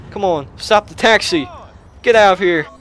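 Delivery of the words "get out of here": ordinary talk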